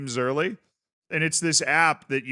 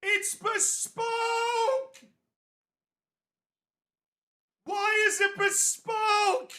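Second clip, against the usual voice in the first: very high, slow cackling madman; drawn-out high mad villain voice; mad scientist; much slower than usual. Pretend. Mocking the word bespoke.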